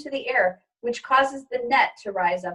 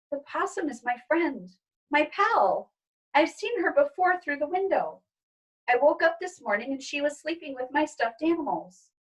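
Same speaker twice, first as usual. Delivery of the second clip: put-on higher little girl pleading; a girl defending her friend; child's voice; 5 semitones higher than usual. Izzy explaining to parents, higher pleading child.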